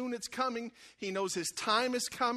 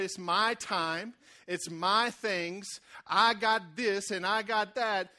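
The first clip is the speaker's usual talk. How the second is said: slow, louder, each word claimed; possessive claiming; 4 dB louder, much slower than usual. Retold or imitated. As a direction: drag it out like a selfish child. Believer taking things back.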